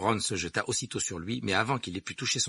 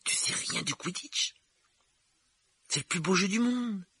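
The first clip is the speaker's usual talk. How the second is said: put-on high, astonished boy's voice; incredulous, high-pitched amazement; flabbergasted; 12 semitones higher than usual. A narrator's reading. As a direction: stretch it out in disbelief, pitched high.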